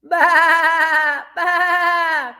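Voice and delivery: high bleating "Ba, ba" like a goat. Shown as a demonstration. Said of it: "know what sound a goat makes?".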